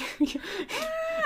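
high, soft clown squeal; squeaky goofy clown giggle; silly clown noise. Pretend. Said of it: "Your silly clown noises aren't helping".

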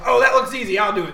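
loud, slightly put-on eager voice; bad character voice. Game master voicing an eager contestant, loud makeshift character voice. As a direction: pipe up loudly in a half-right voice. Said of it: "That was a bad voice for him".

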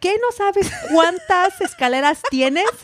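high, exasperated mom voice; put-on nagging mom; imitating scolding mom. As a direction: scold like a fed-up Spanish-speaking mother.

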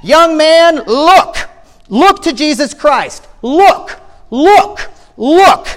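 full-force high pulpit shouts, each word punched; preacher's shout. Acted. Preacher lifting his hands to the young man, shouting. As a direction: bellow it like a fervent Primitive Methodist. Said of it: "he shouted as only a primitive Methodist could".

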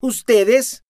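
high, loud, spat-out disbelief; disgusted. Acted. Noemí repelled by the accusation, disgusted. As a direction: spit the word back in disgust. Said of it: "escupió Noemí asqueada".

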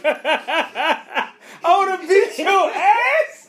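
high, loud laughing yell; cracking up while yelling it; laughing outburst. Real, not acted. Friend hearing the crash story, laughing loudly.

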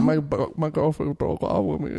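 a gentle, pitiful mock of his sob; lightly mocked sob. Retelling the delivery guy's words, mildly tearful. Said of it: "He was crying, bro".

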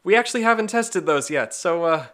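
high, loud, rushed sheepish staff voice; mock nervous staff. Pitching a ride ending, hammed-up sheepish staff voice. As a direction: play a staffer relieved the untested airbags worked. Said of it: "And they're like".

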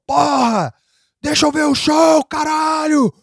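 high-pitched imitation of an angry shout; retold yelling. Held-back angry fan wanting to see the show, imitated yelling. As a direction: mimic an outraged concert-goer yelling. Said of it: "o cara começou a gritar".

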